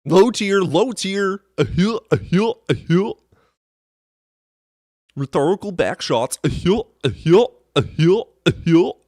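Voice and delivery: high goofy taunt with upturned chuckles; mocking troll. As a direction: mimic a dopey troll guffawing. Mocking the trolls' chant and laugh.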